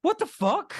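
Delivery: high, squeaky mock outrage in a German-free voice; a sudden high yelp of disbelief at the ending; imitating a fan shocked by the credits scene; stunned disbelief. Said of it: "you're like".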